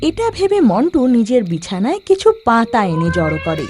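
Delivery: plain reading voice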